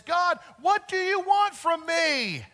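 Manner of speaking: high, slow, breaking cry to the sky; anguished plea to God. A narrator's reading. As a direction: plead upward to God, stretched out and raw. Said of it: "there's this one very emotional scene".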